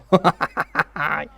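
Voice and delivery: loud burst of cackling; delighted laugh. Real, not acted. Fan thrilled by his defender's speed, delighted laughing. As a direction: crack up with delight.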